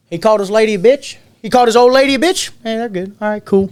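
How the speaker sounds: high-pitched imitated bystanders; mock chorus of unbothered guests; party onlookers. Party guests' shrugging verdict, retold.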